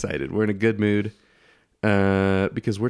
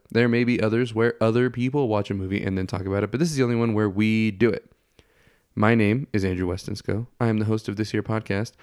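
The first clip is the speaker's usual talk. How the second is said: ordinary talk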